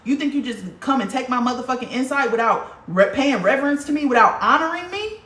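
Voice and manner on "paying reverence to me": ordinary delivery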